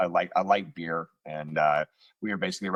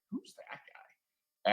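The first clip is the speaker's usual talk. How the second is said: whispering; 7 semitones higher, 9 dB quieter, much slower than usual. Retold or imitated. Asking quietly about the stranger.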